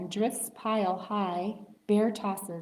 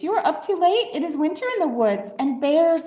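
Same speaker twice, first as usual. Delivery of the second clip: high, louder little mouse voice; squeaky mouse; 8 semitones higher, 6 dB louder, faster than usual. Acted. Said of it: "Mouse squeaks".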